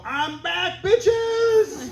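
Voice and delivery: hammed-up resurrected Jesus; triumphant Jesus shout. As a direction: burst out of the tomb crowing. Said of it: "and go".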